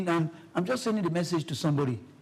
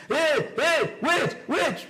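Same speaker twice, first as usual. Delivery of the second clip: retold shouting; 10 semitones higher, much slower than usual. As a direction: shout after the runner to wait. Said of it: "They were shouting".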